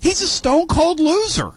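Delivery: high, slow, punched words; emphatic verdict. Real, not acted. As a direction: hammer stone cold loser.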